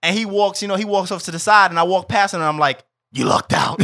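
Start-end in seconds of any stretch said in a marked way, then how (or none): 3.1-3.8 low, gravelly Batman growl. Retold or imitated. Teasing a teammate in a sick Batman growl. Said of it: "I sounded like Batman".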